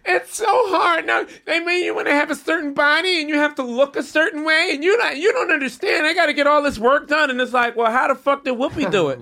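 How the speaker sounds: high, whining complaint voice; whiny Hollywood star. Retold or imitated. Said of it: "everyone is like".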